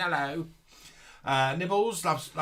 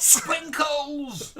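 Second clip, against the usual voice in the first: delighted exclaim; 11 semitones higher, 5 dB louder than usual. Real, not acted. Delighted at a viewer's comment.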